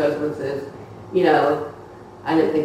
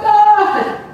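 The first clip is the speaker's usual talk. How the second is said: startled cry; 9 dB louder than usual. Retold or imitated.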